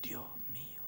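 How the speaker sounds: barely voiced aside; awed whisper. Real, not acted. Preacher moved by the call to rise, awed whisper. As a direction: breathe it out, overwhelmed.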